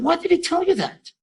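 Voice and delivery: high-pitched retold dismay; startled question. Mother learning her son told a stranger, startled. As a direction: imitate a woman caught off guard, voice way up.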